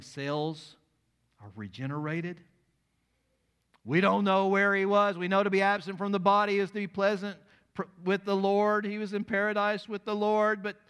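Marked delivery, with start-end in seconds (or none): 3.8-10.8 higher, louder, fast and fervent; preaching at full force. Real, not acted. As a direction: build to the miracle. Preacher climaxes.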